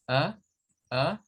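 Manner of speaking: "a" as a short neutral "uh"; isolated "a", a brief lax uh; schwa. Shown as a demonstration. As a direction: mouth barely open on "a". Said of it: "la boca no hay que abrirla mucho".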